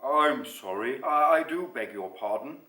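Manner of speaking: mildly voiced polite monster; a hint of a courteous character voice; not at all monstrous. A narrator's reading. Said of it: "voice that was not at all monstrous".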